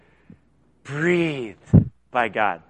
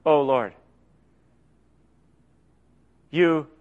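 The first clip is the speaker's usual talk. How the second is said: prayer; 5 semitones lower, 4 dB quieter than usual. Shown as a demonstration. Man showing the church his quiet morning prayer, soft and sincere. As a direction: talk to God gently and sincerely. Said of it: "I have this little impression".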